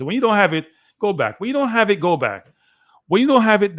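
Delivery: calling to player. Real, not acted. Fan shouting advice at the Jamaican winger, urgent call. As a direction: shout coaching at the winger: when you don't have it, go back.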